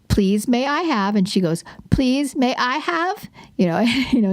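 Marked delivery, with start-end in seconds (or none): 1.9-3.3 higher, slow imitation of a toddler; little kid voice. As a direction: copy a two-year-old parroting mom. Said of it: "in that little kid voice".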